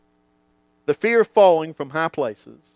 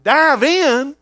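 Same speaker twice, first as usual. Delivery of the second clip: higher, louder bold urging; father's call; 8 semitones higher, 5 dB louder than usual. Retold or imitated. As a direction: call him to jump, encouraging. Father urging son to dive.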